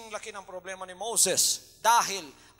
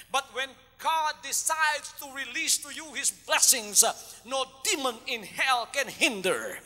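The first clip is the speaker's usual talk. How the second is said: ordinary delivery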